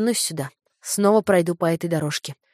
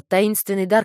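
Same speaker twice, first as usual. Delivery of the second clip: ordinary delivery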